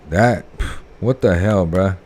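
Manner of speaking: normal talking voice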